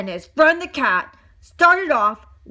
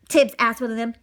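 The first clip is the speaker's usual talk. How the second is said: ordinary delivery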